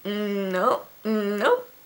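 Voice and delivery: mock-refusing in a put-on voice; a playful brush-off; dismissive nope. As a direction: shake it off with a sassy mm, nope. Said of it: "And I'm like".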